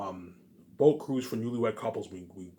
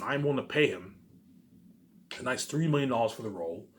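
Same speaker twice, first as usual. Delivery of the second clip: ordinary delivery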